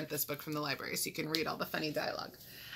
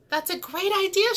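high, loud squeal of approval; hammed-up, high-pitched enthusiasm; excited shriek; 12 semitones higher, 6 dB louder than usual. A narrator's reading. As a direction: shriek your delight at the plan. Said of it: "shrieked Nebraska".

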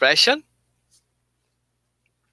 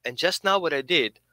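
normal talking voice

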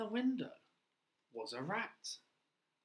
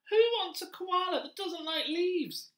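much higher, louder squeaky rat; rat character; 8 dB louder, slower than usual. Acted. Teacher voicing the pet-shop rat to children, high and loud. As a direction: play the pushy little rat, high and loud.

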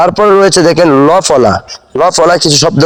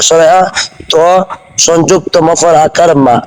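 ordinary delivery

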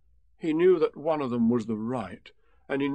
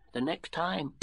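small, higher Piglet voice; timid little Piglet; 5 semitones higher, 3 dB quieter, slower than usual. A narrator's reading. Piglet passes the plan to Pooh, small-voiced. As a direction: pass it on in a small high voice.

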